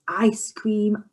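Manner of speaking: usual speaking voice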